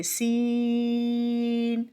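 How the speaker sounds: سین drawn long with a hissing whistle on س; whistled seen. Shown as a demonstration. Tajweed teacher demonstrating whistling سین. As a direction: stretch سین and let the س whistle. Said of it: "سیٹی کی آواز کے ساتھ ادا کریں گے".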